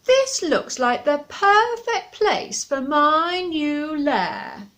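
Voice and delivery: loud, slow, satisfied dragon; greedy dragon. Acted. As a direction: claim the tree like a big beast. Dragon voice in story.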